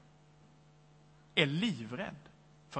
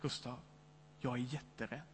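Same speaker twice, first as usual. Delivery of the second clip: much softer, hushed confession; near whisper; 6 semitones lower, 14 dB quieter than usual. Retold or imitated. Old man confides his fear to the pastor, near whisper. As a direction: drop almost to a whisper, afraid. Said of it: "nästan viskar han till mig".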